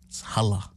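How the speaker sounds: hala said low and drawn, HAH-luh; new word hala. Shown as a demonstration. Dad teaching the new slang word hala. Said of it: "The new word we say instead".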